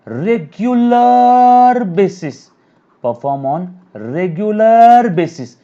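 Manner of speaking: regular basis stretched out high and loud; teacher hammering "regular basis" home; slow stressed definition. Shown as a demonstration. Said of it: "teacher drawing out definition".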